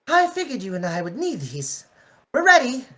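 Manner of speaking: high, refined Rarity. Acted. Rarity calling through the door to Cream, high and refined. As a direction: lift the voice up into a posh pony.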